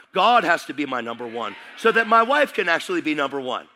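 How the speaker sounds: loud, high preaching climax; full-force preaching. Acted. Pastor exhorting the congregation, fiery preaching. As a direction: preach it hard to the church.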